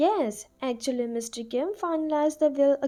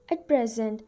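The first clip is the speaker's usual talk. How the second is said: ordinary delivery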